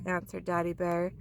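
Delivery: usual speaking voice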